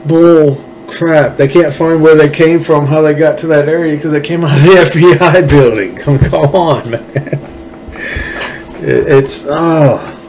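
high, incredulous scoff; scoffing disbelief. Real, not acted. Mocking disbelief at FBI story.

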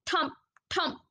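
ordinary delivery

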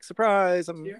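high-pitched mock reveal; mock coming-out. Imitating a reality contestant coming out, high mock reveal.

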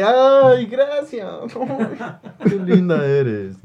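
high, coy mock thanks; hammed-up bashful thanks; mock flattered.

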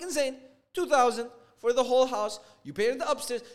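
high, indignant retort; heated protest. Retold or imitated. Acted quarrel over payment.

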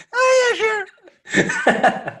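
high squeal breaking into a laughing whisper; squeaky mock voice dissolving into a laugh; annoying girl impression again. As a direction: overdo the whiny girl until you crack up. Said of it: "That was my impression of how annoying she is".

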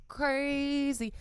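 high, odd-voiced mock echo of crazy. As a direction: drag out crazy in a put-on voice. Echoing her own impression.

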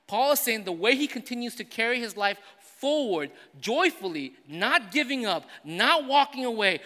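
high, loud, driving preacher voice; loud sermon delivery building through the list; full-force preaching. Acted. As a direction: preach it out to the whole congregation.

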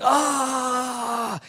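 a short high wailing cry; retold wail. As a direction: let out a sudden high wail. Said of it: "And I am wailing".

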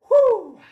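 short, loud, high "woo"; high whoop. Real, not acted. Comedian recovering from sweaty tantrum, loud whoop. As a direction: whoop to shake off the exertion.

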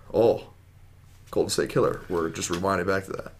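usual speaking voice

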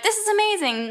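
high-pitched impressed commentator; mock amazement. Acting out amazed skating commentators.

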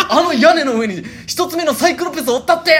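high, very loud mock yell; でっかい声で叫ぶ. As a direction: yell it over the whole train, acting out the cousin. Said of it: "っていうでっかい声で". (車内アナウンス張りの大声で叫ぶ真似)

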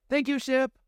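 high, bright thanks; exclaimed thanks. Acted. Pandora thanks the ship AI, high and exclaiming. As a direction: blurt out thanks. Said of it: "Pandora exclaimed".

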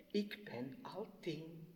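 small, slow whispered answer; a shy child whispering her age. Acted. Little Jessica whispers her age shyly to the mother.

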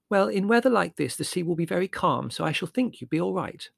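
high-pitched, airy optimism; high girlish figure; reassuring sister. Acted. Voices Margo.

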